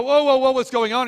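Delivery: high, loud alarmed cry; startled Balaam. Acted. Balaam hearing his donkey speak, an alarmed cry. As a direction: jump back as the donkey talks. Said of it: "Balaam is go".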